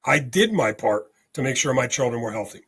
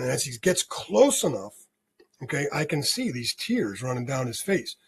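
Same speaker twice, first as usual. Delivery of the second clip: normal talking voice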